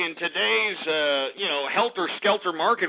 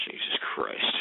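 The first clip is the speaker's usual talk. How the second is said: breathless whispered groan; whispered exasperation; 7 dB quieter than usual. Acted. Host worn out by his own rage, whispered groan. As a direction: let it out on a spent breath.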